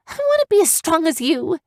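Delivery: put-on high girl's outburst; bursting out. A narrator's reading. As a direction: blurt it out all at once. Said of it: "Evian burst".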